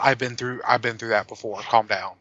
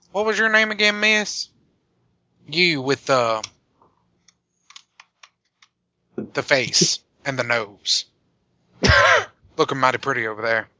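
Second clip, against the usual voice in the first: a hammed-up Southern flirt; Southern accent. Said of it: "That, that accent's horrible".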